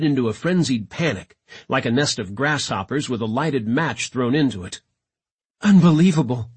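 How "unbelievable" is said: louder, breath-catching astonishment; gasped amazement. A narrator's reading. Jepson amid the leapers' panic, gasping. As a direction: say it with a gasp. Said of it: "said with a gasp".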